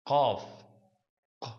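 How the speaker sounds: "Qaf", then "Q" alone as a k made far back; emphatic K. Shown as a demonstration. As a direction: demonstrate "Qaf" with an emphatic, backed k. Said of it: "it's like an emphatic k".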